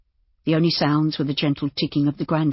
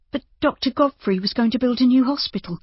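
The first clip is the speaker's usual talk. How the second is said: higher, lighter character voice, puzzled; concerned woman innkeeper; 9 semitones higher than usual. A narrator's reading. Innkeeper questioning the buyer about the hospital, puzzled. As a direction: protest in a raised woman's voice.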